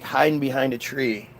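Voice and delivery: normal talking voice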